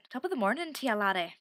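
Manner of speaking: a clumsy attempt at an Irish lilt; bad Irish accent. Shown as a demonstration. Vlogger to viewers, failing at an Irish accent. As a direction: try the Irish accent and miss. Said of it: "master an Irish accent, but I can't do it".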